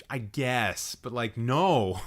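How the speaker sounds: ordinary delivery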